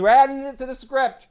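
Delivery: beaming director declaring it a hit; mock thrilled exclamation; excited shout. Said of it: "She goes".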